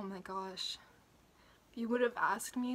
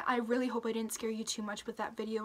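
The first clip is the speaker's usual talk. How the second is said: normal talking voice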